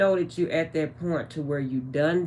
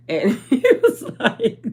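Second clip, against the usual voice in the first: high, loud words breaking into laughter; laughing while talking; 9 semitones higher, 7 dB louder than usual. Real, not acted. Vlogger retelling her ex's call, breaking into laughter. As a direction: lose it halfway through the sentence.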